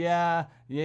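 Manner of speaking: ordinary talk, nothing marked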